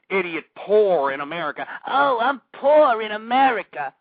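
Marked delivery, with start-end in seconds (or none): 1.9-3.9 slow, louder sneering whine; mocking whine. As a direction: parody a self-pitying complaint. Mocking the poor callers.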